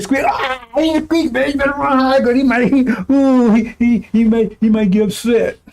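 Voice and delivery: high squeaky mock of a coward; mocking frightened voice. Preacher mocking listeners afraid of Israel, high and squeaky. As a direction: pitch it up, mocking the timid. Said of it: "He said".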